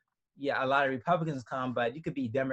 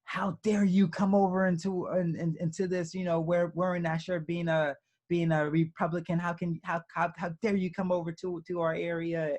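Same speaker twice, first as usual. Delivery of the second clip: higher-pitched mock yell, indignant; mock outraged rant, pitched up; retold yelling; 6 semitones higher than usual. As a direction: imitate an outraged man yelling, at half volume. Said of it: "he started yelling".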